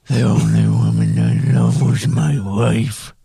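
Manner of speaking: low, gravelly brooding fry; vocal fry voice. Retold or imitated. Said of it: "vocal fry".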